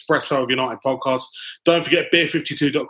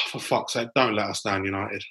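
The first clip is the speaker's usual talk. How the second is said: normal talking voice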